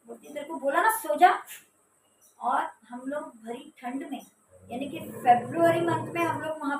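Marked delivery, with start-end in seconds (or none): 0.3-1.5 loud, higher snapping command; angry order. Retold or imitated. Sister repeating her order to sleep, angry. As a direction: cut her off sharply. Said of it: "उसकी आवाज़ बहुत भारी लग रही थी".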